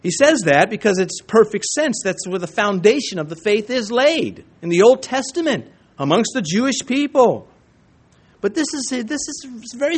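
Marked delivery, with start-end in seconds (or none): none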